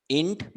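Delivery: "इंट-" alone as the first beat of interesting; isolated teaching syllable "इंट-"; separated syllable. Shown as a demonstration. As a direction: sound out "इंट-" and stop for repetition. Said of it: "चार बार, sir".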